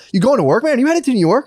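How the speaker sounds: high, eager dude voice; lightly voiced stranger. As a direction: chirp it like an overfriendly stranger.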